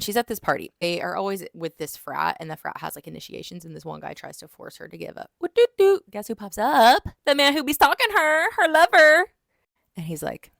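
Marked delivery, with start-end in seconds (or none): 5.4-9.3 high, loud sing-song announcement; playful dramatic reveal. Pretend. Narrator teases the stalker lover's entrance to viewers, hammed-up reveal. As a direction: belt it out like a mock fanfare.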